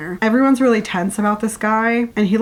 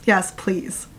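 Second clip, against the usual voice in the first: usual speaking voice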